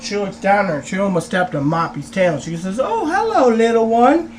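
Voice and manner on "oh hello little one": high-pitched mock cooing; singsong high greeting to a little dog. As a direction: imitate a lady greeting a tiny pet. Lady coos at small dog.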